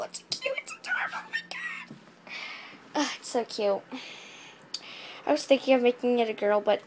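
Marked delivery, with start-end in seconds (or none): 0.0-1.9 very high whispered squeak; squeaky whisper. Pretend. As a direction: breathe it out tiny and high.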